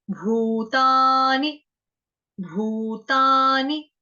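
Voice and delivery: भूतानि stretched very slowly, loud; long vowels. Shown as a demonstration. Teacher stretches the long vowels for students, very slow. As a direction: draw out भू and ता in भूतानि. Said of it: "दीर्घ लगेगा".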